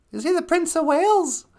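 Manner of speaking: very high, squeaky character voice; high-voiced sidekick. Sidekick asking host about the prince, high character voice. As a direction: ask in a tiny high voice.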